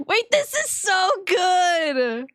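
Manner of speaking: high, drawn-out squeal through laughter; delighted squeal. Real, not acted. Streamer loving the game, delighted squeal.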